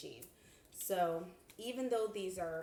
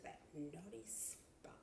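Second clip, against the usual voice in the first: whisper; 15 dB quieter, slower than usual. Acted. Sending the guides to the naughty spot, whispered. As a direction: drop to a hushed whisper on the last word.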